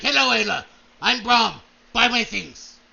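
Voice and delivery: a hearty salesman greeting; Brom voice. Acted. Brom greeting Lord Ravenhold, hearty merchant voice.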